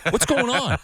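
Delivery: high laughing panic. Retold or imitated. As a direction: squeal it through a laugh. Panicked about losing thumb.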